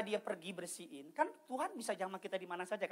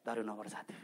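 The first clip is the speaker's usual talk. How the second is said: ordinary talk, nothing marked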